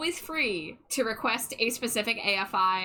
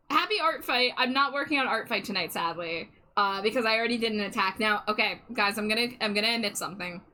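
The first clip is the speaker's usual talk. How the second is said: usual speaking voice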